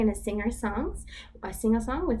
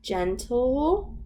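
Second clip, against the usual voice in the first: storybook voice, lively for little ones; reading to children; 5 semitones higher, 3 dB louder, slower than usual. Acted. Teacher reads the picture book to toddlers. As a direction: read it like circle time.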